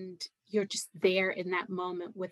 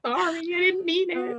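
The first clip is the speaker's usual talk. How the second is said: high, far-off playful sorry; mock apology; 10 semitones higher than usual. Apologizing to mother for sounding American, mock sheepish. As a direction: toss off a sheepish joking apology.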